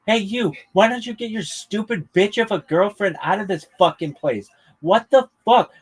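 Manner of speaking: high mock tirade at a heckler; William Montgomery going off. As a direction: rant at the heckler like an enraged comic on stage. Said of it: "He just goes off".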